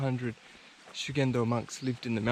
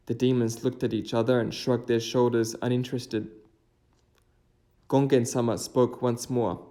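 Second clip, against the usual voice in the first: steady, louder storytelling voice; story narration; 5 dB louder than usual. A narrator's reading. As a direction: tell the old legend to viewers. Narrates a folk tale.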